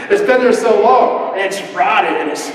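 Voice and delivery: laughing through the words; chuckling while describing it. Real, not acted. Preacher amused by old fridge food, laughing.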